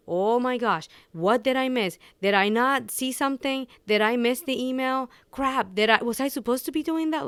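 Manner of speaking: mock panic at a missed email; panicked inner voice. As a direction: replay the frantic self-questioning, on edge. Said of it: "Your brain goes into".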